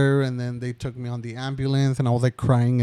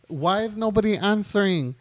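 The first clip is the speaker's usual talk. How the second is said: upset plea; 7 semitones higher than usual. Retold or imitated. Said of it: "I was, like, crying".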